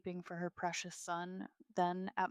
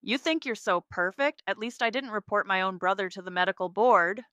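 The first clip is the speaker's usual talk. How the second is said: raised voice, loud and angry; angry accusation; 9 dB louder than usual. A narrator's reading. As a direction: hurl it as an accusation. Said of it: "his face red with anger".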